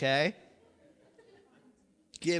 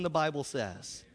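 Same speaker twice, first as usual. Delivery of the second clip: ordinary talk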